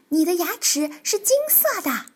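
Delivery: surprised. Acted. Tiaotiao staring at the dentist's gold tooth, surprised. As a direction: gasp it out in wonder. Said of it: "跳跳诧异地说".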